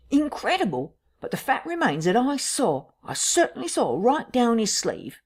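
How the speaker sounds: high, incredulous witness insisting on it; insistent Cuss. Acted. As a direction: push the pitch up, insisting on what was seen. Narrator voices Cuss insisting.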